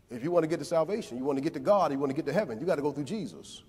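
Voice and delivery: ordinary talk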